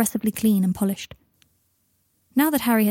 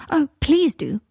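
eager, higher-pitched plea; excited cry; 7 semitones higher, slower than usual. A narrator's reading. Boy begging Teddy to show him, crying out eagerly. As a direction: burst out with childlike eagerness. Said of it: "cried Scorpius".

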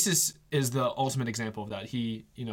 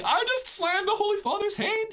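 much higher, frantic imitation of panic; panicked chauffeur. Chauffeur panicking after slamming the pope's hand, high frantic squeal. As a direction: squeal it in horror at what you've done. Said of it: "he was freaking out".

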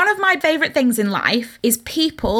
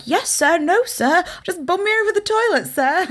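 high, eager little voice, breaking into a laugh; giggly mock-obedient yes sir; polite good boy; 8 semitones higher than usual. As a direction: play the meek obedient boy, pitched way up. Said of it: "He's so polite".

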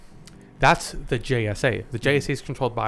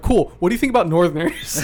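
louder, pitched up baiting question; loaded question, played up loud; mock eager question; 5 semitones higher, 8 dB louder than usual. Leading question, acted.

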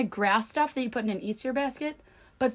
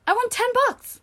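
high thrilled announcement; excited brag; 13 semitones higher, 4 dB louder than usual. Real, not acted. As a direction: squeal the win. Brags about scratch-off win.

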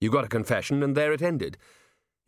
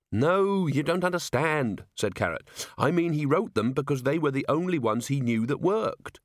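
higher-pitched, insistent explainer; earnest officer; 5 semitones higher than usual. Acted. Officer corrects a squeamish colleague, earnest and insistent.

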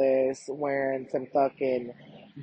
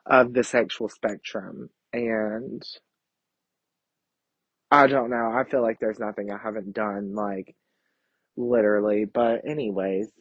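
ordinary talk

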